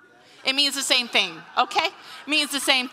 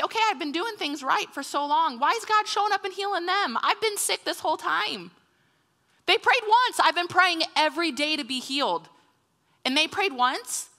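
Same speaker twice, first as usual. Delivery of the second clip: higher-pitched, indignant grumbling; mock-aggrieved whine; resentful complaint; 6 semitones higher than usual. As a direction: protest the unfairness, voice pushed up. Acted resentful complaint to God.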